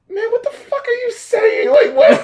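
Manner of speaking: a squeaky, disbelieving outburst; high, drawn-out mock outrage; mock baffled squeal. Baffled reaction to lyrics.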